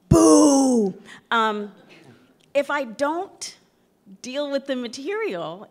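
loud, high jeering boo; playful jeer at the idea; mock boo. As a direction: boo it like a heckler in the crowd. Mocking students' trauma concerns.

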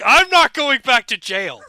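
loud, high villain imitation; hammed-up cry of defiance; mock defiant yell. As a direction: shout it like a cornered convict. Acting out villain's defiant line.

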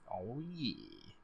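soft, smug murmur; a quiet, self-satisfied aside; proud dad. Acted. Gamer voicing Sebastian proud in the background, quietly smug. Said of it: "He's just so proud".

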